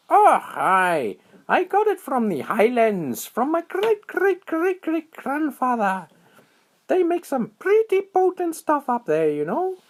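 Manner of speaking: very high, excited little squirrel voice; high squeaky critter voice. A narrator's reading. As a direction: chirp it out like a tiny squirrel. Squirrel voice held.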